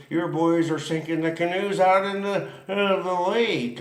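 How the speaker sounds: mock general reporting trouble; the general, alarmed. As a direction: camp general reporting the sinking canoes, mildly mocked. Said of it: "He goes".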